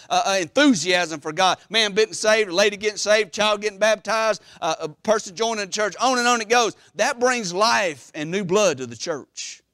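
ordinary talk, nothing marked

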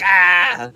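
loud, high acted "ah"; mock cry of pain. Dano gets shot at the door, mock yell. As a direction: yell as if just hit.

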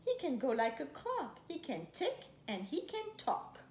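ordinary delivery

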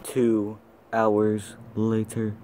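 slow dramatic announcer. Acted. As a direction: announce it slowly like a title card. Announcer time card.

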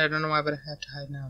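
quiet, fast nervous mutter; hushed and hurried. Real, not acted. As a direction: hurry it out under your breath. Hiding from monster, hushed.

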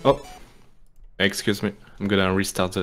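usual speaking voice

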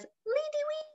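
very high, light birdsong; high imaginary bird song; bird call. Pretend. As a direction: chirp it like a little bird. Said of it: "This one says".

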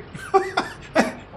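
unvoiced cackle; delighted laugh. Commentator watches the rescue, cackling. Amused as teammate pushes Tiger.

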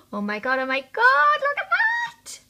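high, loud squealing delight; shrieking jackpot winner. Real, not acted. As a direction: shriek like you just won. Opens the last gloss.

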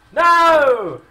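high, loud drawn-out no; alarmed shout. Real, not acted. Player begging for Pidgey's life, alarmed shout. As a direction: cry out in protest.

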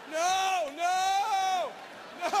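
loud, high, panicked no's; frantic no. Real, not acted. Comic yelling at the TV to stop. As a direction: beg the song to stop.

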